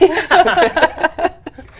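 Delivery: high, loud burst of laughter. Real, not acted. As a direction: crack up hard at your own joke. Laughing at own movie joke.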